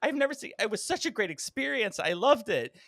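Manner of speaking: hammed-up theatre fan gushing. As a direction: gush over the show like a fan. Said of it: "she was like".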